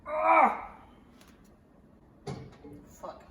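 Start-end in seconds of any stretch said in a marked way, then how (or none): 0.1-0.7 loud high scream; startled scream. Real, not acted. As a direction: scream at the mishap. Something goes wrong.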